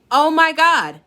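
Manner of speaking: high, loud oh my God; shocked exclamation. Real, not acted. Viewer hears the scam was common, high and loud shock. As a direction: gasp it out in disbelief.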